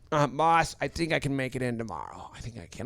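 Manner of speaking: fading, soft pitiful voice; weak sick voice. Retold or imitated. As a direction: trail off, sounding too sick. Sick man fakes weakness to boss.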